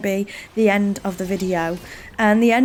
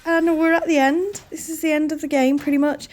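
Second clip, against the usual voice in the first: emotional; 7 semitones higher than usual. Real, not acted. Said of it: "oh, I'm gonna cry".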